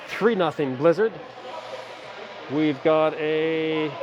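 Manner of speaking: steady live game call; hockey play-by-play. Acted. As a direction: call the action for the stream. Calm play-by-play after break.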